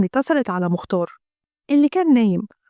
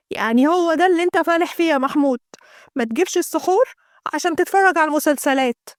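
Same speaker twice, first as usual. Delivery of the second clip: nagging wife; 3 semitones higher than usual. Acted. Abeer scolds Mahmoud for forgetting the beans, exasperated.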